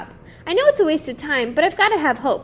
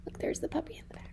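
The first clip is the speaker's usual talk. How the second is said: quick hushed whisper aside; 5 semitones higher, 16 dB quieter, faster than usual. Real, not acted. Reader pointing out the puppy to listeners, quick whisper. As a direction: lean in and whisper it fast.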